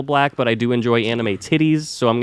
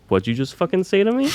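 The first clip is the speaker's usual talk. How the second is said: high, challenging "what'd you say"; confrontational; 8 semitones higher than usual. Retold or imitated. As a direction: pick a fight, outraged. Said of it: "And he goes".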